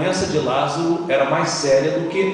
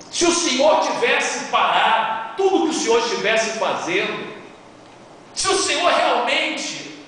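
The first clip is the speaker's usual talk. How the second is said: accusing; 8 semitones higher than usual. Shown as a demonstration. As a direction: hurl it at him as a bitter accusation. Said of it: "a entoação de Marta foi uma entoação de acusação". (em tom de acusação, alto e agudo)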